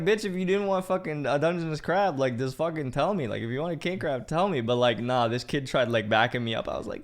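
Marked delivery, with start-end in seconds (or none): 0.0-4.6 higher-pitched retold rant; indignant telling-off. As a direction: tell off the customer in exasperation.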